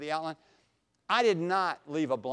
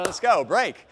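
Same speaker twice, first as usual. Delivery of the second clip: cheer call; 5 semitones higher, 10 dB louder than usual. Retold or imitated. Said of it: "Our football cheer was".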